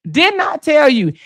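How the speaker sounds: high-pitched insistent retort; hammering reproach. Retold or imitated. Officer standing over the man she warned, hammering reproach. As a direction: imitate her beating the words into him. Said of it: "she beat it in him like".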